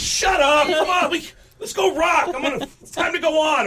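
high loud yell to drop it; mock flustered yell; high yelled protest. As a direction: shout them off the subject. Drummer cuts off the joke.

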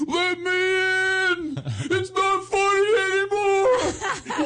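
high, slow, laughing half-crying wail; hammed-up desperate whine; whiny panicked plea. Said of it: "He goes".

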